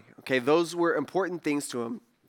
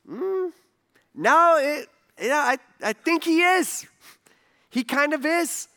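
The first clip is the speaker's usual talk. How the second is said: high-pitched hedging contradiction; reluctant disagreement; 9 semitones higher, 3 dB louder, slower than usual. Retold or imitated. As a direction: disagree gingerly in a raised pitch. Retells hesitantly disagreeing with an acquaintance.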